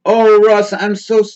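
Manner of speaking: high, loud gushing fan voice; gushing mock sympathy. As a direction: gush over him like a fan. Mimics gushing commenter.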